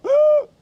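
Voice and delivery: a sudden loud high gasp of alarm; sharp gasp. Pretend. Gasping at imagined brake failure while driving. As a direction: gasp as if the brakes just went.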